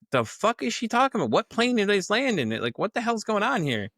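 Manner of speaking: high disbelieving voice; utterly confused; incredulous. Retold or imitated. He wonders what she means, high and incredulous.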